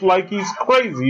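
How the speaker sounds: ordinary talk, nothing marked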